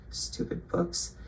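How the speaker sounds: whispered under breath; muttered aside. Real, not acted. Annoyed at books, a whispered mutter. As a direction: grumble it to yourself. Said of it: "muttered aside".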